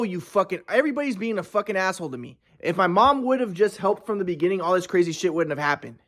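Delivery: ordinary talk, nothing marked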